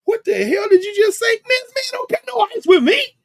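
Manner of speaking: hammed-up indignant old woman; indignant elder. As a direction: play the outraged elder who won't take it. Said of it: "looking like".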